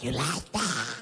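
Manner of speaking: a mock little demon hiss. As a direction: growl it like a tiny demon, slowly. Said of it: "Sounded like a little demon".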